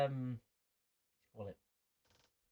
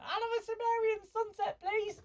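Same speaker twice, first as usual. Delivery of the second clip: much higher squeaky bar order; high-pitched voice; 4 dB louder than usual. Pretend. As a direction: order a drink in a tiny squeaky alien voice. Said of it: "he has a really high-pitched voice".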